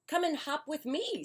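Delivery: high rabbit invitation; bouncy rabbit. Acted. Rabbit inviting Duck to hop, high voice.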